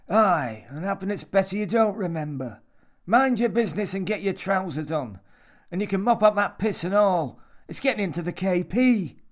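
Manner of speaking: high, loud, bossy telling-off; gruffly scolding old man. A narrator's reading. Old man tells Jimmy to clean up his mess, scolding.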